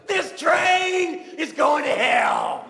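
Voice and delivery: imitating a preacher roaring at the top of her lungs; street preacher yell. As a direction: bellow it like a street preacher damning the car. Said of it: "at the top of her lungs, she yelled".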